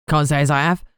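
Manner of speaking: broad Cockney bloke; thick Cockney. A narrator's reading. Ghoul informant asked about a lord's death, broad Cockney. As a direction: answer offhand in thick Cockney. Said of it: "The Cockney accent came out thick and broad".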